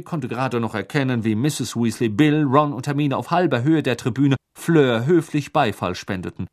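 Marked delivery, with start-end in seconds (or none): none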